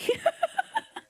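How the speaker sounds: a high squealing giggle. Real, not acted. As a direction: burst into a high-pitched laugh. Laughing at tiny Goomba heads.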